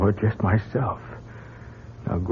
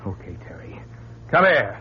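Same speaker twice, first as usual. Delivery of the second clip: menacing whisper; 2 semitones lower, 4 dB quieter than usual. Acted. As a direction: whisper the threat close.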